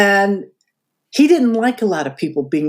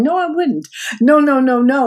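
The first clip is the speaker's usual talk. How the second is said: high-pitched string of protests; retold insistent refusal; 6 semitones higher than usual. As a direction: bat it away in a raised pitch.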